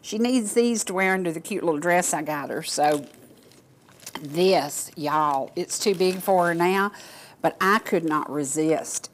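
usual speaking voice

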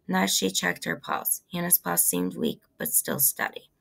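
plain reading voice

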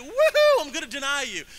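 high, loud whoop, then lively; hammed-up excited cheer; mock excitement. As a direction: whoop it like gleeful good news. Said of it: "It's not like he got excited".

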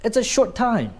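short kept brief and high; short emphasized. Shown as a demonstration. Teacher matching word to tone, short kept short. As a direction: say short quickly, high, then fall on time. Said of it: "When you want to emphasize short".

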